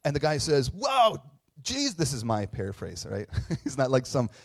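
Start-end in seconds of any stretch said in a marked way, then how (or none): 0.7-1.9 high, loud mock surfer whoa; eighties surfer dude. Retelling a man's reply to Jesus, hammed-up surfer amazement. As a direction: gush like an awestruck surfer dude. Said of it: "eighties surfer dude".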